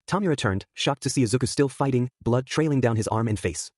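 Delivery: usual speaking voice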